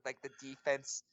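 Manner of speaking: ordinary talk, nothing marked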